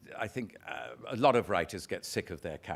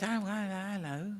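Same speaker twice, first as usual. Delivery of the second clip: put-on higher delighted old lady; 9 semitones higher, slower than usual. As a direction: squeak it like a thrilled little old lady. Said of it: "And she says".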